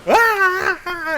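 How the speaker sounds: very high shrill monkey shriek; high screeching animal cry; monkey scream. Acted. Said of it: "take the hat and go".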